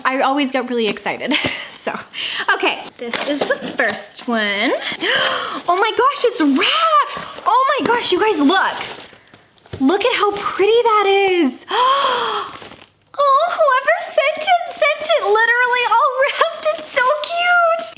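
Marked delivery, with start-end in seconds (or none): 4.8-5.6 delighted gasp. Real, not acted. Opening a wrapped package. 5.7-18.0 high, gushing excitement over the gift; thrilled. Real, not acted.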